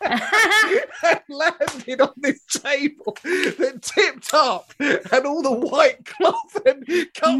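high, giggly laughing through the words; can't stop laughing at the memory; laughing while telling. Real, not acted. Teller recalling the cane fight, laughing high.

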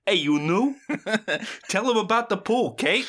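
high-pitched mock guy accosting a newcomer; fifties dad type. Guy in a sweater vest to the new kid, high put-on voice. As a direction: imitate an eager character, pitched well up. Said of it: "And he's like".

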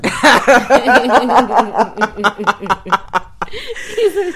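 big loud laugh; loud burst of laughter. Real, not acted.

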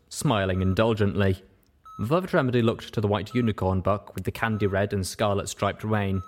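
usual speaking voice

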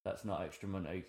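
low-volume, quick muttered thought; hushed, hurried thinking aloud; inner thought. Retold or imitated. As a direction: think it to yourself, very softly. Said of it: "in my head, I was thinking".

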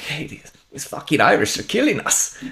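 high, indignant German soldier; put-on German-accented English. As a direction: complain in a comic German accent. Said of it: "German accent in English very well".

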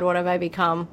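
ordinary talk

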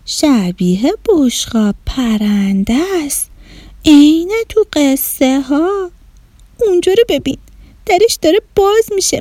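put-on high, childlike voice rising in alarm; a small boy watching something strange; little boy voice. Neshat describing the spaceship to his sister in a childlike voice.

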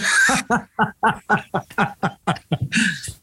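long high giggle; laughing. Real, not acted. Laughing after the song.

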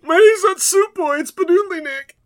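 put-on high, loud whiny kid protest; indignant kid. Kid insisting on his real name, high indignant whine. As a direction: squeak it out like an offended boy.

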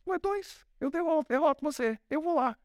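put-on cocky boy squaring up to the giant; a hint of swagger; defiant young David. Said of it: "E Davi olhou e falou".

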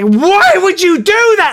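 loud, high, slow shriek of reproach; re-enacted furious victim; outraged. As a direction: demand it in appalled disbelief. Shocked victim confronts prankster.

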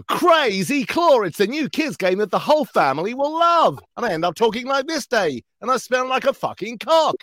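high, hyped cartoon advert voice; kids' ad voice. Shown as a demonstration. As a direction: sell the toy like a hyper kids' ad. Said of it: "I do voices on your T V".

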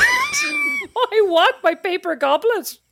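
high, slow, laughing shout; laughing squeal. Pretend. Laughing mock whine about goblet.

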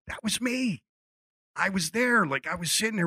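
put-on telling Sylvester he was that kid, excited; excited reveal.

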